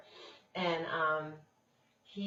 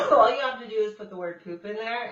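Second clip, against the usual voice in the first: ordinary talk, nothing marked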